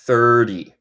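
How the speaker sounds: "thirty" with the T like a Spanish R, "thur-dee"; "thirty" said American-style as "thurdy"; American flapped T. Shown as a demonstration. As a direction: tap the middle T of "thirty" lightly. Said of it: "yo digo un T en el medio".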